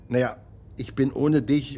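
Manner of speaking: ordinary delivery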